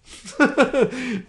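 loud, high incredulous laugh; high burst of laughter. Real, not acted. Scoffing at the coworker's Google Translate jab with a laugh.

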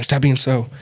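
usual speaking voice

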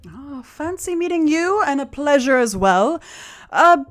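high-pitched gallant halfling voice; charming halfling, courting. Acted. Halfling asking his rescuer about evening plans, courting charm.

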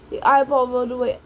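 ordinary delivery

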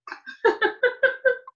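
a real laugh of delight; high delighted laugh. Real, not acted. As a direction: laugh high and bright at the memory. Laughing at son's naming.

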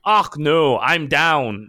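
mock Scottish accent. Explaining what the dwarf seems to say, Scottish accent. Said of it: "he sounds like he's going".

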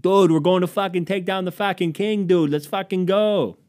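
loud, high rallying cry; amped bro, stretching the last words; hyped-up Boston friend. Acted. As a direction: pump up your buddy like a rowdy Bostonian. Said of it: "I probably would've lived in Boston 'cause".

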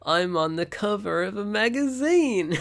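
high, slow mock Mike voice; lightly voiced Mike; imitating Mike. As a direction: do Mike's smug boast. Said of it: "Half the things that Mike says".

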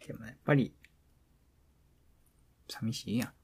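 usual speaking voice

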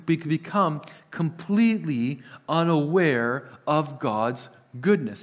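ordinary delivery

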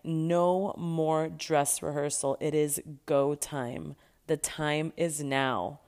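slow, deliberate urging; slow firm urging. Retold or imitated. Friend urging her to quit now, slow and deliberate.

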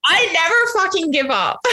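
high, laughing burst of pride; real laughter breaking through the words; laughing declaration. Real, not acted. Laughing through her big declaration.

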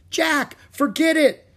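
high, loud protest; urgent shout. Acted. Annie to Jack running back, urgent shout. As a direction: yell at him to give up.

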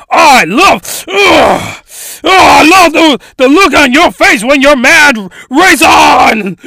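loud, high, leering gloat; gloating shout. Acted. Villain crowing loudly at the captured heroine, leering shout. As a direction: crow over her at the top of your voice.

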